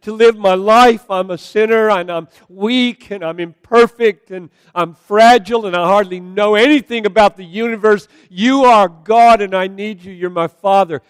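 loud, high, impassioned cry to God. Acted. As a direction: cry out to the Father with full force. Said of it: "when you cry like that to your Father".

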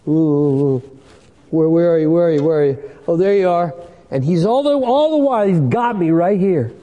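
hammed-up pulpit play-acting, full volume; excited acted search. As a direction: play out a loud hunt that ends in discovery. Acts out searching and being seized.

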